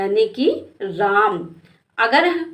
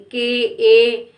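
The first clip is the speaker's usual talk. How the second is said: slow spelling out; 3 dB louder, much slower than usual. Shown as a demonstration. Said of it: "के ए का प्रयोग करेंगे".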